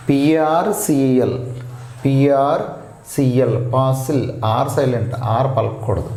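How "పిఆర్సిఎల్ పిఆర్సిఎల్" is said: letters spelled out slowly one by one; slow spelling. Shown as a demonstration. Teacher slowly spelling the word for the class. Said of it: "next పాసిల్".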